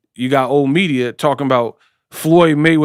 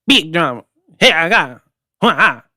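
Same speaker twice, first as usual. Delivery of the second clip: short mock-accented bursts; Triple G; 3 semitones higher than usual. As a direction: imitate a boxer's accent as punchy hype grunts. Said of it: "Like [name]".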